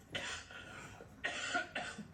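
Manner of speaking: deep rattling cough; a real chesty cough. Real, not acted. Coughing fit mid answer.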